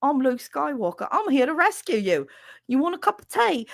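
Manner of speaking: put-on high, peppy British accent; chirpy Englishman offering tea; peppy British Luke. Streamer doing a peppy British Luke Skywalker. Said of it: "it was too peppy".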